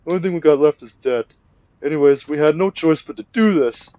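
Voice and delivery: high, loud whiny excuses; pleading kidnapper. Retold or imitated. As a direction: plead your case like a cornered man. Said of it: "And he's just like".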